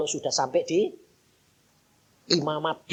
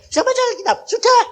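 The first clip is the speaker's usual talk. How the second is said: mock quiz; 8 dB louder, faster than usual. Mocking typical churchgoer answer.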